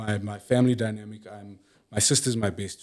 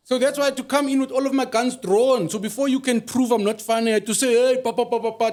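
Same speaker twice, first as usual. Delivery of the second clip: excited; 12 semitones higher, 5 dB louder than usual. Real, not acted. Guest hyped up insisting he's funny.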